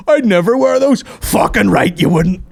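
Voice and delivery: high, loud sneering snob; mock snob. Mock snob then retort.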